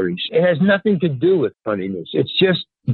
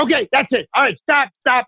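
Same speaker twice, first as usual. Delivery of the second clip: pitched-up impatient halt, imitating; mock exasperated shout; 10 semitones higher than usual. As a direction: cut him off like a fed-up director. Director ends the audition abruptly.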